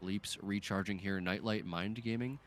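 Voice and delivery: ordinary talk, nothing marked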